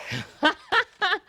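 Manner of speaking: high burst of laughter. Real, not acted. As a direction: laugh out loud after wrapping up. Laughs after ending the talk.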